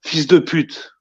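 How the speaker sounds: Fils de pute as fees duh pewt, French vowels; French pronunciation. Shown as a demonstration. Player teaches the French insult, native pronunciation. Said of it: "How'd you say what?".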